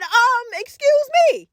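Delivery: high, sharp mock-offended "excuse me"; sassy excuse me. Annoyed at cousin opening bag.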